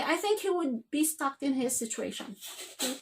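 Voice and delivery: normal talking voice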